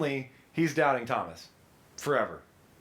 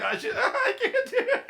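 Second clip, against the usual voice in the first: very high, laughing too hard to speak; imitated helpless laughter. Player laughing too hard to read the cards, imitated laughing fit. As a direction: mimic someone cracking up mid-sentence. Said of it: "starting to laugh so hard".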